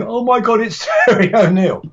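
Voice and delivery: high, giddy oh my God with a laugh; laughing starstruck fanboy squeal; childlike excitement. Retold or imitated. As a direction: gush like a starstruck child meeting his hero. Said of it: "I was actually like a child".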